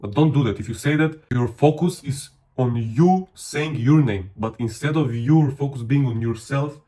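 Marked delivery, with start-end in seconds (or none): none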